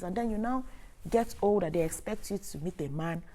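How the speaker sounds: normal talking voice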